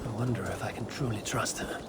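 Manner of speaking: unvoiced whisper to herself; whispered aside. Alone after the meeting, whispering her doubts about Cassandra. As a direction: whisper your doubts so no one hears.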